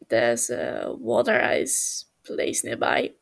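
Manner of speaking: deep, slow, unhurried suggestion; low slow offer. Acted. Techno offering Tommy a treat, low and slow. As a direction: drop low and take your time.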